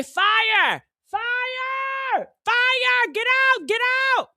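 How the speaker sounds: high shouted fire, fire; yelling fire. Pretend. Raising the alarm so everyone flees, shouting high. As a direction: raise the alarm at the top of your lungs. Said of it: "I might say".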